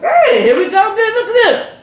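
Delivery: high, loud, laughing delight; excited. Real, not acted. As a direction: squeal with joy over food. Excited over the food.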